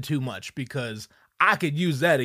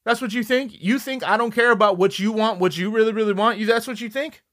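exasperated retort; 6 semitones higher, 4 dB louder than usual. Retold or imitated. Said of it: "I'm like".

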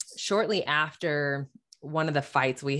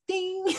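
bell ding; 4 dB quieter than usual. Retold or imitated. Mimics bell of realization.

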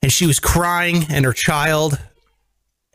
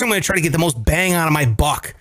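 ordinary talk, nothing marked

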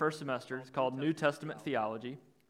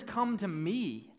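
"me" drawn out slowly, pitched higher; stress on ME; 3 semitones higher, much slower than usual. Real, not acted. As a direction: come down slowly and firmly on "me". Contrasts with rejected wordings, emphatic.

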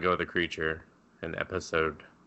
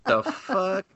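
baffled snake; 8 semitones higher, 3 dB quieter, much slower than usual. Retold or imitated.

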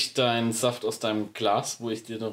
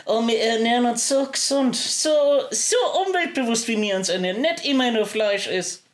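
mocking posh voice; 8 semitones higher than usual. Mocks the avocado-eaters.

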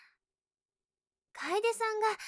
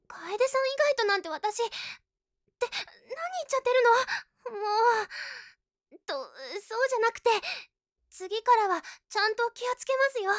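flustered; 3 semitones higher, 4 dB louder, faster than usual. Acted. Flustered after blurting something out.

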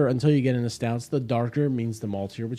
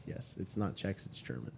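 dropping to a low, soft deadpan; deadpan aside; 9 semitones lower, 19 dB quieter than usual. Real, not acted. Host delivers the German pun as a quiet deadpan throwaway. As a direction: throw it away, trailing off low.

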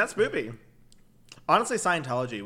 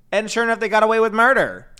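outraged; 9 semitones higher, 11 dB louder than usual. Real, not acted. Outraged about Casey Anthony verdict.

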